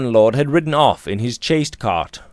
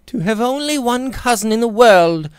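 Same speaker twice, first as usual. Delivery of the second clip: put-on higher young lady's voice, hurt and passionate; a proud girl near tears, crying out; passionate young woman; 12 semitones higher, 3 dB louder than usual. A narrator's reading. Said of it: "she cried passionately".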